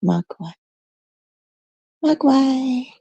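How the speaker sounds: a hint of baby talk; cooing the name. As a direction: call the plush toy sweetly.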